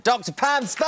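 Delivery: loud, high announcer call of the guest's name; big welcome. Real, not acted. Host welcomes the guest psychologist, calling out loud and high. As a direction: ring out the name like a showman.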